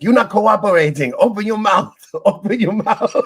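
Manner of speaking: high, loud mock scolding from a Chinese dentist; exaggerated bossy dentist shouting orders; imitated yelling. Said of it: "the woman's yelling at her".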